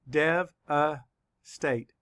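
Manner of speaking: very slow, Dev-a-state with a clear break between parts; syllable by syllable. Shown as a demonstration. Teacher counting syllables for students, slow and split. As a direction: stretch out Dev-a-state beat by beat. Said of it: "Let's count the syllables".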